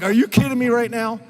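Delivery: imitating incredulous wife. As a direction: mimic a wife's teasing disbelief. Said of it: "She's like".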